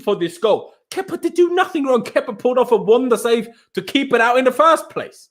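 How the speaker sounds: usual speaking voice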